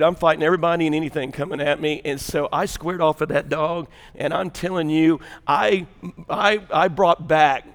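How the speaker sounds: ordinary talk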